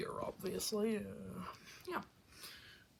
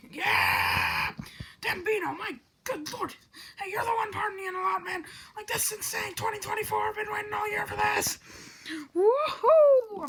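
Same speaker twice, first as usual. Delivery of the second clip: wild, much louder and higher cheering; cheering shout; 11 semitones higher, 10 dB louder than usual. Acted. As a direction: scream with joy at midnight. Continued new year cheering.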